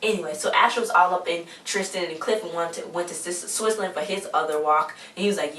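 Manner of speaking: ordinary talk, nothing marked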